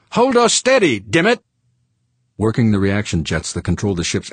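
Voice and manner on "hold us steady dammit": very high, loud cursing order; urgent shout. A narrator's reading. George ordering pilot while aiming, urgent shout. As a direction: yell at the pilot to hold on.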